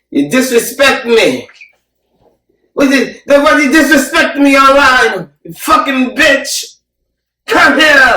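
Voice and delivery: high, loud broken-English rant; imitating broken English. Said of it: "That's how he sounded".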